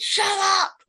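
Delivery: high, loud parental yell; yell at the kids. Retold or imitated. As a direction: parent yelling at noisy kids. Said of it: "me yelling at the kids".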